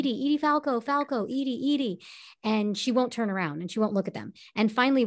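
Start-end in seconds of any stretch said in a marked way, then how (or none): 0.0-2.0 higher-pitched calls of Edie, mock shouting; paparazzi calling. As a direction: imitate photographers calling out across a red carpet. Paparazzi calling her name.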